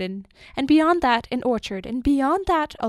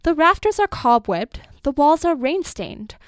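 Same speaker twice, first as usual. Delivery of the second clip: loud, raised denunciation; impassioned outburst; 5 semitones higher, 6 dB louder than usual. A narrator's reading. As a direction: declaim against the confining house. Old man denounces his house.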